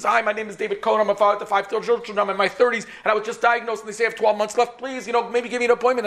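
high-pitched, urgent retold plea; urgent appeal. Sick father begs secretary for an appointment, urgent plea. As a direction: plead urgently, pitched well up. Desperate caller asks for appointment.

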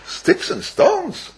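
high, slow incredulous scoff; scoffing disbelief. Retold or imitated. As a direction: drag it out in mock disbelief. Socrates scoffs at weeping prophet.

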